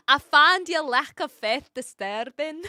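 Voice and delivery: loud, hammed-up Scottish quote; exaggerated Scottish accent. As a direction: boom it out in mock Scots. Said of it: "my best attempt at a Scottish accent".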